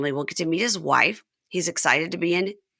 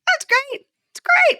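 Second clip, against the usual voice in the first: squeaky falsetto, far higher, louder at the end; high-pitched John impression; 5 dB louder, slower than usual. Host imitates John's answer in a squeaky falsetto. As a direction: push it way up into falsetto. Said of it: "And he is like".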